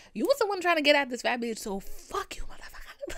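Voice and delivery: fast, high retold outburst that strains away; losing it. Firing back at the friend, losing it in a rush. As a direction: race through the comeback, then choke out the curse. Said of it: "just losing it".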